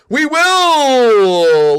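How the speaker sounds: high, loud, drawn-out cry; emphatic shout. Real, not acted. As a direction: belt out the promise, stretching the last word. Emphatic answer to the chat.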